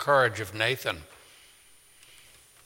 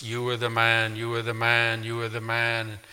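echoing conscience; 3 semitones lower, 5 dB quieter than usual. A narrator's reading. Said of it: "it echoes over and over".

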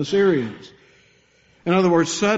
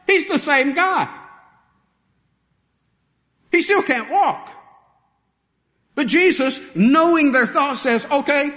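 preaching at full force; 8 semitones higher than usual. Acted. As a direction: drive the story home from the pulpit. Preaching builds to a peak.

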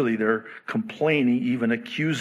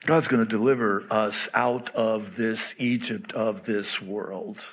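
ordinary talk

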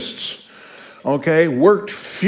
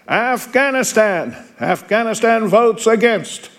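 calling the nation out higher, slow; roll call announcer; 6 semitones higher than usual. Acted. Teacher reading the roll call to students, called out higher. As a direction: announce the country to the hall.

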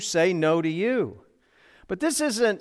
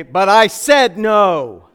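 louder and drawn out, every word pressed; slow emphatic insistence; 2 semitones higher, 9 dB louder, slower than usual. Retold or imitated. Preacher joking to the congregation he refused a dog, slow firm insistence. Joke about losing dog argument.

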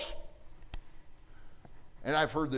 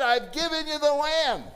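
much higher and louder, proclaiming; booming voice of God; 12 semitones higher, 5 dB louder than usual. Acted. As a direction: announce it like God from above. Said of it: "preacher voicing God".

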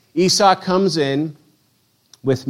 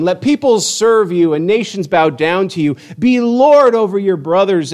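declaimed scripture blessing; 4 semitones higher, 3 dB louder than usual. A narrator's reading. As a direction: pronounce a blessing, higher and measured.

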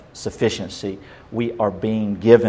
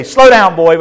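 higher, louder scolding shout; mock angry yell; 5 semitones higher, 9 dB louder than usual. Stepfather scolding his running stepson, loud mock shout. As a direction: bark it at the boy like an angry stepfather.

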